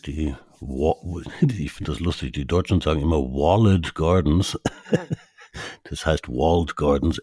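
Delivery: usual speaking voice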